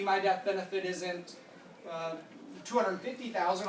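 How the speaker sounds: usual speaking voice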